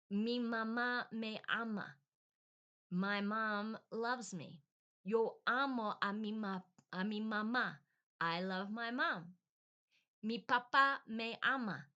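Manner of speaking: loud, deliberate beginner's recitation; first-grade reading aloud. Retold or imitated. Adult learner reading a first grade book, emphatic recitation. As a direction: read out each simple sentence emphatically.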